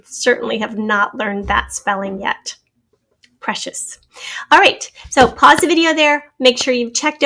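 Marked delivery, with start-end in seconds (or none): 3.4-4.0 Precious whispered with no voice; Precious repeated softly in a whisper; whispered word. Shown as a demonstration. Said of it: "the word is number twenty-two".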